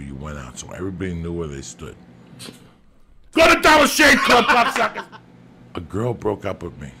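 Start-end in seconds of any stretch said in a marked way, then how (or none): 3.3-5.1 much higher, much louder yelled ad plug, ending in a laugh; hammed-up ad read yelled at the listeners; mock ad shout. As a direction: bellow the sponsor plug like a carnival barker.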